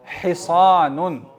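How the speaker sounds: हिसानुन drawn out with a thick S; heavy saad. Shown as a demonstration. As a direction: make the S in हिसानुन full and emphatic, slowly. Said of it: "स्वाद को भी मोटा किया जाता है". (حِصانٌ، صاد موٹا کر کے)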